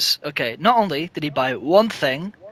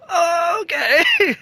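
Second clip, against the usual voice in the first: high, squeaky surprised okay; startled little okay; taken-aback oh okay; 13 semitones higher, 5 dB louder, much slower than usual. Retold or imitated. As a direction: pitch way up in mock surprise. Reacting to customer's glare.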